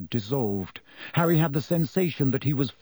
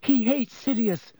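put-on higher, pleading girl's voice; desperate Hermione; 9 semitones higher than usual. A narrator's reading. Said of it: "Hermione said desperately".

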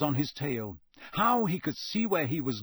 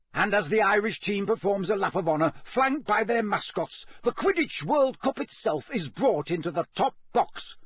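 high, raised stadium-announcer voice; Bagman roaring; 6 semitones higher, 3 dB louder than usual. A narrator's reading. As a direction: proclaim it to the whole stadium. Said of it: "roared Bagman".

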